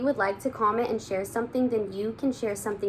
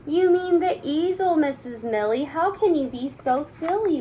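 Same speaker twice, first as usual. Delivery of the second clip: high, loud, exasperated; excited kids; 8 semitones higher, 5 dB louder than usual. Acted. Kids exasperated with their teacher, high and loud. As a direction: squeal it at the silly teacher.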